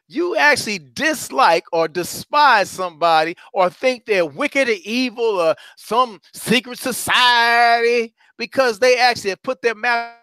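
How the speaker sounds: ordinary talk, nothing marked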